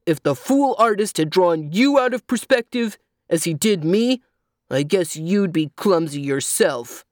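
gruffly indignant donkey voice; angry donkey. A narrator's reading. Donkey blames the artist to the child, angry. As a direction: complain crossly as an offended donkey. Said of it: "demanded the donkey with angry voice".

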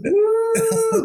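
high loud victory woo; excited whoop. Real, not acted. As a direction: cheer it out loud. Celebrating after spelling URL.

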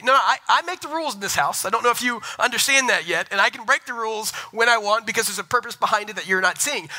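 high, insistent pretend retort; mock-exasperated parent. Father wants to tell daughter who makes the rules, mock exasperation.

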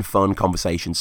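ordinary delivery

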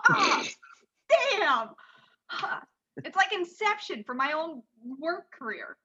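ordinary talk

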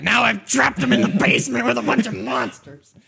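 high-pitched, exclaiming protest; indignant outburst. Real, not acted. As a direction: burst out in over-the-top self-vindication. Indignant retort to teasing players.

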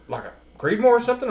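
high-pitched mock-puzzled question; puzzled guess. Strangers guessing at his caliber, puzzled. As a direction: imitate a baffled questioner guessing.

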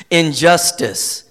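usual speaking voice

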